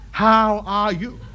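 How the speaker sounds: "How are you" stretched out slowly; laboriously slow repeat; slowed for a foreigner. Acted. Repeats slowly for uncomprehending foreign listener.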